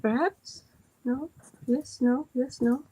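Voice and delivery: ordinary delivery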